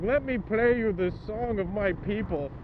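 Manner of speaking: hammed-up meme delivery, slow and loud; drunk friend meme voice. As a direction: announce it grandly like a drunk college buddy. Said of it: "he would just be like".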